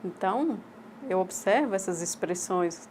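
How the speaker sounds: ordinary talk, nothing marked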